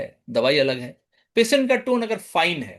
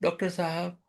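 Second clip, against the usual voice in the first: moaning plea; 2 semitones lower, 8 dB quieter than usual. Shown as a demonstration. Patient moaning softly to his doctor, a mild moan. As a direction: groan the name like you can barely speak. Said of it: "वो मोन कर रहा है".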